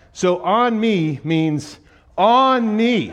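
slow and loud, each word spelled out; slow emphasis. Real, not acted. Explaining the literal kids, slow loud emphasis. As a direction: lay it out slowly for the joke.

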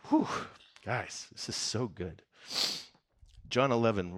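ordinary delivery

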